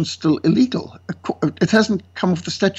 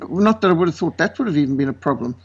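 usual speaking voice

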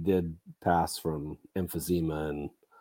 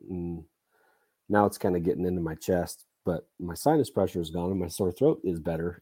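ordinary delivery

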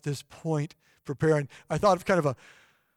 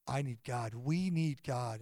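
hushed plea; 5 semitones lower, 11 dB quieter than usual. Retold or imitated. Plea to listeners, soft slow.

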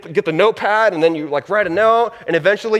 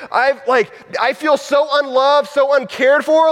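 hurt, raised mock outburst, higher pitch; blowing up; 7 semitones higher than usual. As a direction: play the wounded roommate venting. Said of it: "you just blow up at them".

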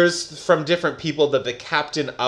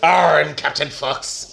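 mock pirate arr; slower than usual. Pirate growl for the name.

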